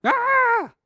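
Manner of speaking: sudden high loud shriek; shocked high-pitched "Ah!"; wife's scream. Acted. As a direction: let out a sharp, full scream. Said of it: "His wife started screaming".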